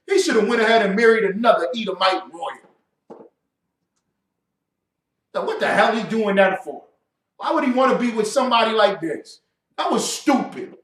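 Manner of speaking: loud, exasperated rant; angry scolding. Real, not acted. Rant at Harry continues.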